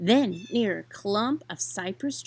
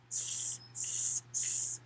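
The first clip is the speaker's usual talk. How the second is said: soft drawn-out hissing; quiet breathy snake hiss; 12 dB quieter, much slower than usual. A narrator's reading. Snake hisses.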